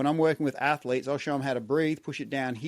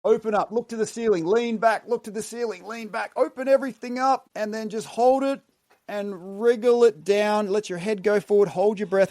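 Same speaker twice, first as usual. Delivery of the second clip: higher, louder coaching cues; energized breathwork coaching; 5 semitones higher, 3 dB louder than usual. Shown as a demonstration. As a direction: lift them up with rising energy. Guided breathwork inhale cues.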